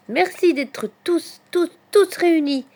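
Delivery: high, slow, building to a loud drawn-out call; festive cheer. A narrator's reading. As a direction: toast the friends, swelling at the end. Hedgehog voiced, festive closing cheer. (acclamation festive, aiguë et traînante)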